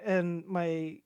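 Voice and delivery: ordinary delivery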